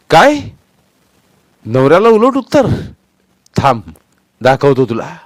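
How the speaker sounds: very loud, high enraged bellow; husband roaring at his wife; furious shout. Acted. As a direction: explode at her and advance. Husband explodes.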